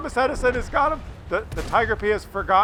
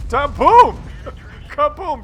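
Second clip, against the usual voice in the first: laughing shouted kaboom; bursting with glee at the hit; mock explosion; 3 semitones higher, 8 dB louder, slower than usual. Laughing at the tank shot.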